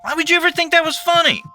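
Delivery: high, loud furious yelling; aggressive roar. A narrator's reading. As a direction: roar it at him in fury. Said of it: "roared in perfect aggression".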